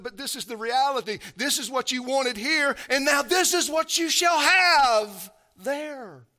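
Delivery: loud, high, impassioned preaching; preaching at full force. Acted. Preacher pressing the eviction on the church, loud and fervent. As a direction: thunder it to the congregation.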